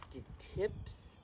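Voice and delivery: plain reading voice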